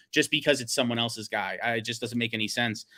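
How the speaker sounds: ordinary talk, nothing marked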